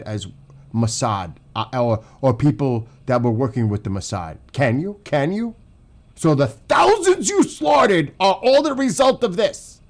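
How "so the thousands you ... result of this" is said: loud, high, drawn-out angry shout; outraged accusation. Real, not acted. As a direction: hurl the accusation at full voice, stretching the words. Outraged accusation over the killings.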